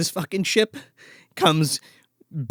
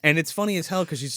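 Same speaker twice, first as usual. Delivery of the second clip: ordinary delivery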